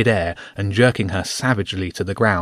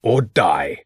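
snarl. A narrator's reading. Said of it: "Gralf snarled".

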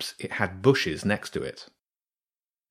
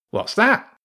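much higher, louder sharp question; sudden question; 13 semitones higher, 5 dB louder than usual. Acted. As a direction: cut in, startled. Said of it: "the first voice cut in".

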